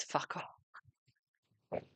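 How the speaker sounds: hushed, voiceless cuss; whispered swear. Real, not acted. Reader whispering a swear so viewers won't hear. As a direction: breathe it out as a whisper.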